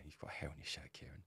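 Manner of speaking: softly, kindly; soft friendly hint about hair on your shirt; soft gentle version. Shown as a demonstration. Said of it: "totally different".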